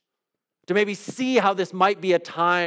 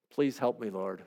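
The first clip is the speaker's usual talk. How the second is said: hushed plea; 6 semitones lower, 9 dB quieter than usual. Acted. Closing plea in prayer.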